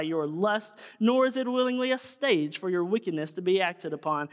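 put-on fire-and-brimstone pulpit read of an old sermon; seventeen hundreds voice. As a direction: thunder it out like an eighteenth-century evangelist. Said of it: "I gotta get my seventeen hundreds voice on".

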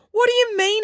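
very high, indignant question; high-pitched exclamation; exclaiming. A narrator's reading. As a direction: burst out in protest. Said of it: "exclaimed Ian".

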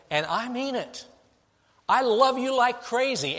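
put-on God speaking to his wayward child, fervent and insistent; God's insistent voice. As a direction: plead and insist, voicing God's love. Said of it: "Jesus is God saying".

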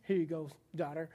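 low, soft, solemn parent handing over keys. Retold or imitated. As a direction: hand over the keys with weighty gravity. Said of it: "and they're like".

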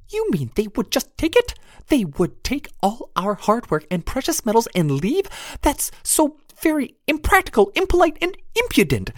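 tiny high pixie, indignant; squeaky pixie, prim outrage. Acted. Narrator voices pixie Jenny.

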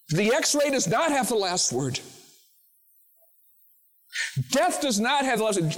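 preacher proclaiming Christ's rule to the congregation, full force; preaching at full force. Acted. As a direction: build it up like a revival climax. Preacher builds to climax.

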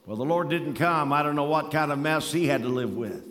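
normal talking voice

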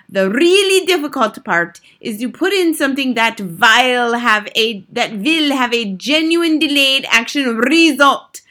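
lightly voiced commanding witch; theatrical witch giving orders; imperious head witch. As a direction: dictate a wicked recipe with emphatic relish. Grand High Witch lectures the audience.

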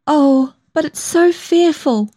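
high, slow, tearful lament; sobbed. A narrator's reading. Young woman sobbing to her lover over her mother, high and slow. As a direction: draw it out high, on the edge of sobs. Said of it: "I sobbed".

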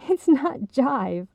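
slow, laugh-choked correction; gasping with laughter. Acted. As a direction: fight through the giggles. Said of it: "Audrey gasped".

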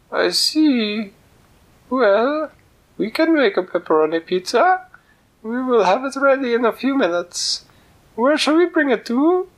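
sing-song it like a tiny cook; high cook voice. Acted. Kitchen cook on phone.

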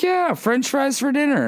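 high-pitched mock mom voice, falling at the end; upbeat mom. Mom confirming french fries for dinner to her kid, high mock-cheery.

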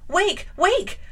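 high, loud shout to wake him; urgent cry. A narrator's reading. Jane shouting at sleeping Rochester amid the fire. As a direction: yell to rouse a sleeping man. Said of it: "I cried".

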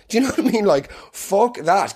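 ordinary delivery

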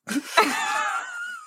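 high shrieking laugh. Real, not acted. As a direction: burst into a squealing laugh. Laughing at own joke.

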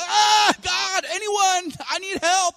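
high, drawn-out mock wail for help; desperate crying out. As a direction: act out a panicked plea to anyone listening. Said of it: "we're, like, crying out".

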